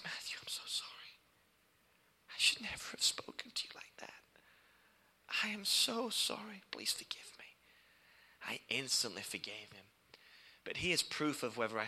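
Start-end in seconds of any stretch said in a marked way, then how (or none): 0.0-7.5 pastor's broken apology, whispered; tearful whisper. Retold or imitated. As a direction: whisper it like a remorseful man near tears. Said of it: "he suddenly starts to sob".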